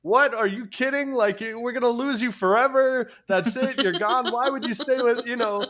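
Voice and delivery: high, loud voice of scoffing monks; hammed-up disbelief, pitched high and pushed; mock incredulous monks. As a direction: tease like a crowd of scandalized monks. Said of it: "all the monks erupt in laughter".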